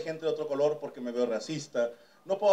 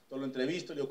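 ordinary talk

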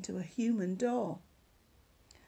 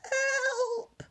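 much higher wail for help; 4 dB louder than usual. Acted. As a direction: wail it into the empty valley. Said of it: "she wailed".

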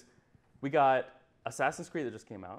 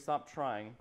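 usual speaking voice

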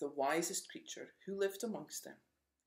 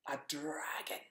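breathy hushed whisper of dread; dramatic whisper. A narrator's reading. Narrator whispers the dragon's arrival to frightened listeners. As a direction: whisper it like a scary secret.

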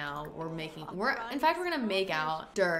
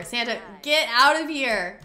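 loud, urgent shout; yelling at Santa; 3 semitones higher, 9 dB louder than usual. Real, not acted. Host yells at Santa to get away from her, loud. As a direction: shoo him off, loudly.

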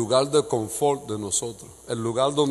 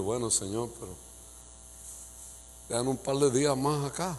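meek reply; 8 dB quieter than usual. Retold or imitated. Pastor's meek reply to God.

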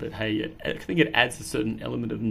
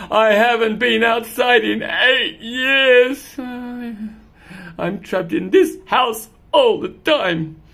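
slow, mildly sad complaint; a touch of self-pity; slow mild moping; mopey cat lament; 12 semitones higher, 10 dB louder, much slower than usual. Acted.

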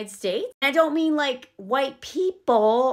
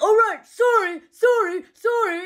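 high, loud mimicked sorry; hammed-up sing-out sorry, pitched high; mocking apology; 8 semitones higher, 5 dB louder than usual. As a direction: make fun of someone's apologizing. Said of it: "And then he makes fun of that".

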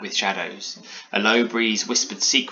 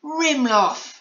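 very high, loud cry of wonder; amazed exclamation; 5 dB louder than usual. A narrator's reading. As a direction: exclaim like an amazed little girl. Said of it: "she exclaimed".

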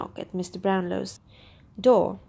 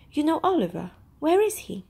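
higher-pitched eager questioning; urgent surprise; 7 semitones higher than usual. A narrator's reading. Brownlow asks the visitor about missing Oliver, urgently surprised. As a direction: ask urgently, voice lifting high. Rises quickly, eager for news.

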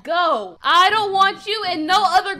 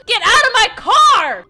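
loud, raised-pitch angry order; explosive, fed-up command; furious shout; 5 semitones higher, 9 dB louder than usual. Acted. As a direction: yell to throw them out. Acted breakup, throwing someone out.

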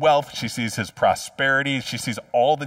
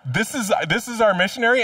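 higher, disbelieving question; incredulous church member; 7 semitones higher than usual. Retold or imitated. Church members reading the missionary's email, higher and incredulous. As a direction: ask it in comic disbelief at the news. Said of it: "we'd be like".

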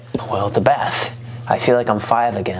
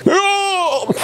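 much higher yelp of pain; pained high cry. Real, not acted. Toe caught under the tub, high pained yelp. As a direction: yelp as the toe gets hit.

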